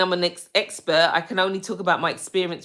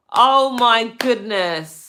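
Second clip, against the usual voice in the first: ordinary talk, nothing marked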